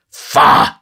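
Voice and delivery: loud, explosive "faugh" of indignation; loud scandalised scoff; disgusted exclamation. A narrator's reading. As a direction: blurt out scornful disgust. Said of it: "in a strong indignation".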